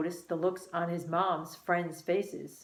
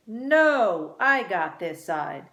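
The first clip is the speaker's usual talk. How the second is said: loud refusal; 7 dB louder, slower than usual. A narrator's reading. Car-seat quarrel.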